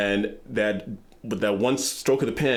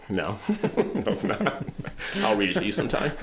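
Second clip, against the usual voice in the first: laughing; 4 semitones higher, 3 dB quieter than usual. Real, not acted. Laughing at Samuel L. Jackson joke.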